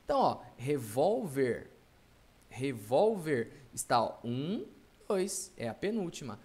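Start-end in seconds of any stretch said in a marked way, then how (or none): 0.6-3.5 "Revólver" with "vól" punched; stressed penultimate syllable. Shown as a demonstration. Teacher shows the second-last syllable, stressed. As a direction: lean slowly on the "vól" of "Revólver". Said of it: "cuja sílaba tônica se encontra na penúltima".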